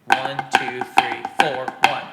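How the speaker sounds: "one, two, three, four" each punched loud; counted downbeats. Shown as a demonstration. Drum teacher showing where flams fall, emphatic count. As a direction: count evenly, every number an accent. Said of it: "on all the downbeats".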